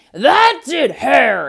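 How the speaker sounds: loud furious holler; hollering bear. Acted. Bear hollering at Hare after being tricked, loud. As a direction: bellow his name in rage. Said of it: "he hollered".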